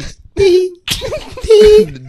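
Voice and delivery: shrieking burst of laughter; loud high laugh. Real, not acted. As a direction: howl with laughter. Reacts to butt tickles joke.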